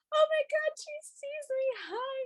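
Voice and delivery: tiny high-pitched fangirl voice; re-enacting being thrilled, softening to a squeak; starstruck squeal. Fan noticed by Kim from the stage, starstruck high squeal. Retelling Kim pointing at them.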